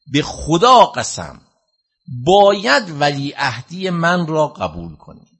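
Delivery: loud, slow, high oath of a furious caliph; retold angry shout. Preacher voicing Ma'mun shouting at Imam Reza, loud slow fury. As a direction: hammer each word as Ma'mun in a rage. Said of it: "فریاد زد گفت".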